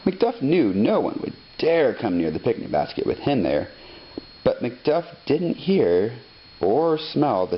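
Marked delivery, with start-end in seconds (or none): none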